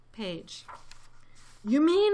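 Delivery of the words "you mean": high, loud amazement; astonished gasp. A narrator's reading. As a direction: gasp it out in disbelief. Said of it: "Horton gasped".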